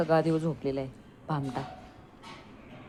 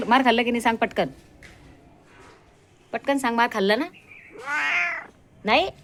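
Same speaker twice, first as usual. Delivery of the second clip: loud, higher teasing questions; mock interrogation; 6 semitones higher, 5 dB louder than usual. Real, not acted. Owner loudly teasing her dog about the beating. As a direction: grill the dog playfully.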